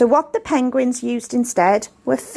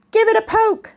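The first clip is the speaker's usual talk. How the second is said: much higher, eager penguin; 10 semitones higher than usual. A narrator's reading. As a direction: squeak it out like a keen little penguin. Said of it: "said Penguin Prue".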